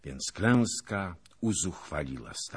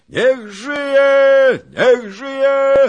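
full-throated, very high cheer; wild yelled hurrah; crowd shouting; 18 dB louder, much slower than usual. A narrator's reading. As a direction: roar the acclamation at the top of your voice. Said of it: "krzyczała szlachta".

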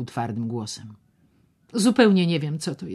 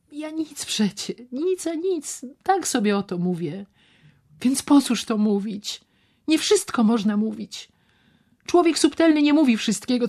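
much higher and louder, rushing on; excited man voiced high; 8 semitones higher, 6 dB louder than usual. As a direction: talk himself into the plan eagerly. Szczerbic's excited rambling.

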